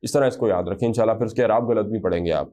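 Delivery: usual speaking voice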